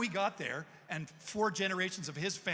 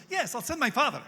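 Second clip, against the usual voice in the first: a lightly voiced eager reply, higher and louder; imitated garage owner; 7 semitones higher, 6 dB louder than usual. Garage man on the phone offering his father, lightly voiced and eager. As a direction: pop it out brightly, a touch higher and louder. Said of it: "He goes".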